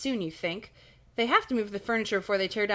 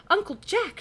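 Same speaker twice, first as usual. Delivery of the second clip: high-pitched shocked cry; gasping storybook exclamation, pitched way up; 12 semitones higher, 4 dB louder than usual. A narrator's reading. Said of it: "she cries".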